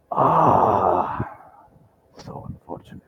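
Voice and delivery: long sighed groan into near whisper; groan, then let the words fade to breath; pained regret. Acted. Merchant hears bad news about Hector, pained hushed regret. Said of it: "grit his teeth and close his eyes".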